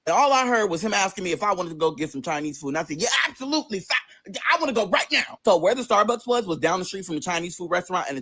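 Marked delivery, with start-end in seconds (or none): 3.0-5.4 high, eager acceptance; eager yes. Retold or imitated. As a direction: jump at the offer, pitched up. Eagerly accepting food invitation.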